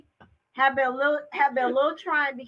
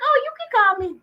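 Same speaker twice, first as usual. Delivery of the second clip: bright friendly offer; 8 semitones higher, 5 dB louder than usual. Retold or imitated. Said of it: "And I'm like".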